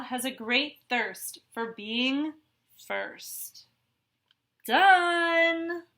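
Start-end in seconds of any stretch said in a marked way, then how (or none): 4.7-5.8 high, loud cry of victory; bursting winner's yell; triumphant shout. Acted. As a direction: shout it like you just won the race. Straw races to finish first.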